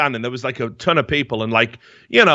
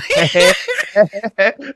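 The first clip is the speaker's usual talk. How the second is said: laugh; 9 semitones higher, 3 dB louder than usual. Real, not acted. Laughing at own meltdown.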